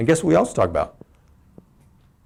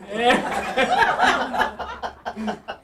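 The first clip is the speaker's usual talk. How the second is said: high laugh; 13 semitones higher than usual. Real, not acted. Laughs at own joke.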